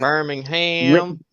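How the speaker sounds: Birmingham slowly, ham stretched and rising; drawn-out Alabama pronunciation. Shown as a demonstration. Teasing the Alabama way, slow stressed ham. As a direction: drag out Birmingham, lean on the ham. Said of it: "emphasis on the ham".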